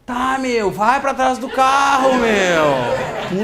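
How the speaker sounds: drawn-out, high mock-angry order; acting out a fed-up robber yelling; pissed off. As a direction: stretch the command, exasperated. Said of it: "Puto da cara, né?".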